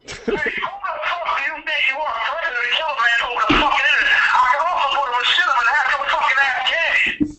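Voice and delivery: a long, high, derisive cackle of a laugh. Real, not acted. Long mocking laugh on air.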